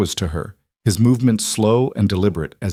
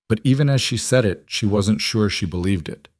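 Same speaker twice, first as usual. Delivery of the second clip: usual speaking voice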